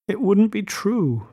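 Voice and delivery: high, slow, pitiful admission. A narrator's reading. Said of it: "she admitted in a pitiful voice".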